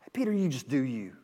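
a soft, lightly voiced laid-back Jesus; a hint of a mellow, permissive Jesus; mock easygoing. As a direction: shrug it off, softly, as a joke. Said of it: "That's not what he said".

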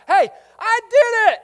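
very high, loud excited shout; pumped. Retold or imitated. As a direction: crow it like a proud kid. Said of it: "He is pumped".

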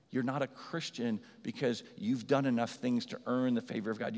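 ordinary talk, nothing marked

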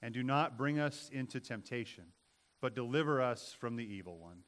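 slow, hushed spoken prayer. Acted. Pastor leading the children in prayer, slow and reverent.

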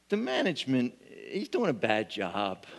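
normal talking voice

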